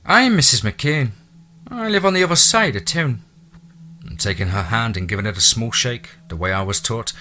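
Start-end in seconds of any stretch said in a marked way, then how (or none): 0.0-3.2 higher, louder Irish brogue; a chatty Irish housekeeper's brogue; thick Irish brogue. A narrator's reading. Irish housekeeper welcoming the new owner, thick Irish brogue. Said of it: "thick Irish brogue".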